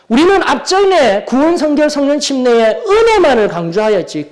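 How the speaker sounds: high, loud insistent preaching; forceful preaching. Real, not acted. Preacher insisting the church must study the Bible, forceful. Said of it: "이렇게 강하게 시작할 수밖에 없어요".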